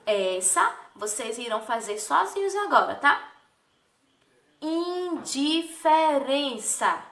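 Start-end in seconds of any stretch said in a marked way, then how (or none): 4.6-7.0 "Indiferença" slowly, syllable by syllable; higher, half-speed sounding-out of "Indiferença"; part by part. Shown as a demonstration. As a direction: separate and stretch each syllable of "Indiferença". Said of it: "parte por parte".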